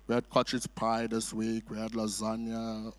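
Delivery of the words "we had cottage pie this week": normal talking voice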